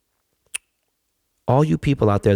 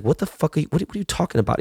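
livid teacher; 7 semitones higher, faster than usual. Retold or imitated. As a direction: retell the teacher's angry disbelief. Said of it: "the teacher was fucking livid".

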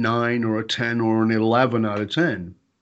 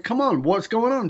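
high-pitched retold exasperation; a bit pissed off; 10 semitones higher than usual. Husband retelling frustration at his wife, a bit pissed off. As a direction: plead with mock impatience. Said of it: "a bit pissed off".

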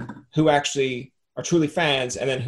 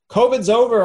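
excited announcement; 9 semitones higher, 7 dB louder than usual. Retold or imitated. Someone announcing covid is over.

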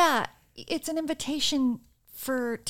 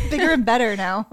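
laughing; 8 dB louder than usual. Real, not acted. Laughing while quoting.

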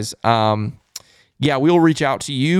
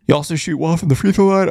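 through a yawn; 4 semitones higher than usual. Real, not acted. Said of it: "The rare yawn".

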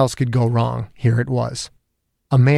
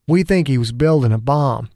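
plain reading voice

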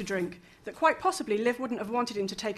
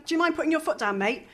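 impatient; 7 semitones higher, 4 dB louder than usual. Acted. Fraser urging the cab driver.

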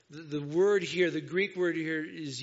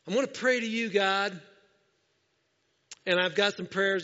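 demanding prayer; 2 semitones higher, 5 dB louder than usual. Retold or imitated. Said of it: "worshiping him on their terms".

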